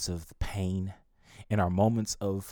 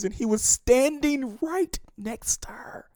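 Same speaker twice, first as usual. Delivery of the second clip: building from raised to very high, emotional cry; urgent, swelling, final words stretched out; impassioned preaching; 10 semitones higher, 5 dB louder than usual. Real, not acted. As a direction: preach it up to an anguished peak. Retells Mary's despair, building to climax.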